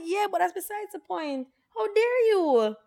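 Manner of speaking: a hammed-up diva asking how dare you; mock indignation. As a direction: play up the fake outrage at the ex. Her mock outrage at the ex.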